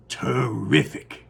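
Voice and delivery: drawn-out marvelling; slow awe. Acted. Grandpa Joe marvels at the idea, slow. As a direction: savour the word.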